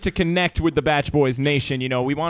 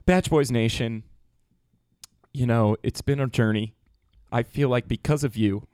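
mock sincere farewell; 5 semitones lower, 4 dB quieter than usual. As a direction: play the heartfelt Bachelor goodbye. Mock-solemn Bachelor rose farewell.

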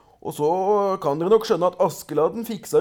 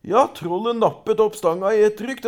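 ordinary delivery

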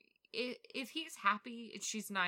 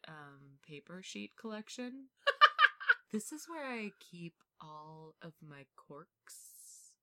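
spooky butler. Acted. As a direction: hush it like a eerie butler showing his house. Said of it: "sounds like a spooky butler".

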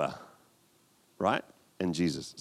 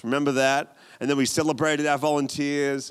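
ordinary talk, nothing marked

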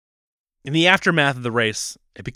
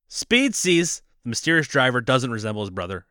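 ordinary talk

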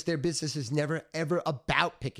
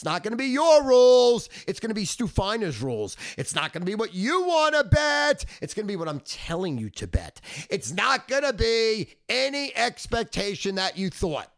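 loud and forceful; 6 semitones higher, 5 dB louder than usual. Real, not acted. Lays down his rules.

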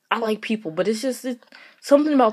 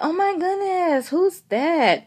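higher, slow mock gasp; mock surprised coworker; 8 semitones higher, slower than usual. Coworkers asking who her dad is again, mock surprise. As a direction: ham up a clueless coworker's surprise. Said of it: "coworkers asking about my dad".

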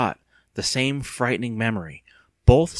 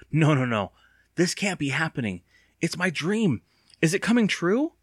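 mounting alarm; 7 semitones higher than usual. Acted. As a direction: dread climbing higher line by line. Evan's alarmed thought, rising pitch.

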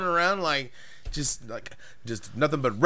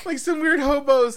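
excited; 11 semitones higher, 4 dB louder than usual. Real, not acted. Excited high remark.

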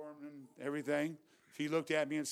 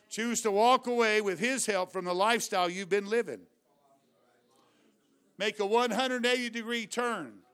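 louder, driving sermon cadence, words pressed out; preaching at full force; 3 semitones higher, 6 dB louder than usual. Acted. Preacher urging the congregation to repent, full-force preaching.

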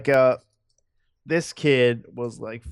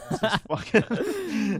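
mock announcement; 10 semitones higher, much slower than usual. Joking about naming a kid.

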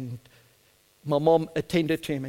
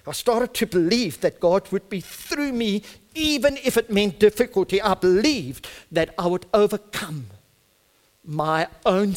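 raised, forceful pulpit delivery; impassioned preaching; 4 semitones higher, 4 dB louder than usual. Real, not acted. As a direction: preach it with rising conviction.

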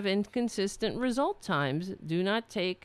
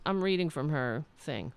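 ordinary talk, nothing marked

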